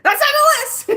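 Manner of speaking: very high, loud thrilled cry; excited shout. Retold or imitated. Seeing the candy shop, shouting with excitement. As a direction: burst out with glee.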